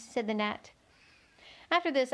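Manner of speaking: plain reading voice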